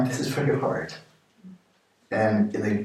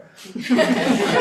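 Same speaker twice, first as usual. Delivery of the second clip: quick breathy laugh; 14 semitones higher, 4 dB louder than usual. Real, not acted. Teacher laughing at "I'm not a car". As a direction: break into a short laugh.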